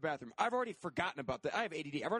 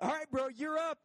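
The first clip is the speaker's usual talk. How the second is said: imitating a pushy dealer; bro voice; 9 semitones higher than usual. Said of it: "he does the".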